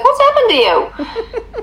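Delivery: high, slow, laughing mock horror; mock dismay. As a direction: gasp it like a shocked aunt. Mock dismay at sugar habits.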